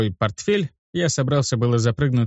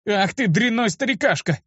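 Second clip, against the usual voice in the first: indignant cry; 10 semitones higher than usual. A narrator's reading. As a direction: snap at the old man in mild outrage. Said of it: "крикнул я на него". (возмущённый выкрик)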